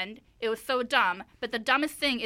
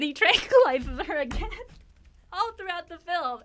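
laughing through it; 6 semitones higher, 5 dB louder than usual. Real, not acted. Laughing while retelling absurd plot.

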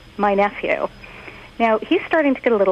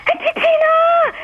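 put-on high, excited little boy's call; excited child calling; 4 dB louder than usual. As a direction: call out like a kid running in. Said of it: "I hear this voice going".

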